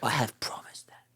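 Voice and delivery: hushed, breathless realisation; panicked whisper. Retold or imitated. Princess whispering as she remembers her promise. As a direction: whisper it in sudden dread, I have promised that.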